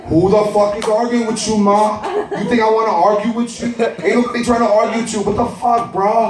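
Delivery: high, aggressive street voice; older brother barks at his mother and sibling, high and aggressive; tough older brother. Acted. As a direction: pick a fight, all bravado. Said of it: "And here comes Duncan, my older brother".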